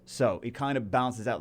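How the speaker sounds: ordinary delivery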